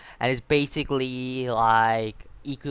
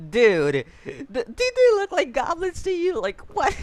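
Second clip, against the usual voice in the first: incredulous reaction; 6 dB louder than usual. Pretend. Mock reaction to the goblins line.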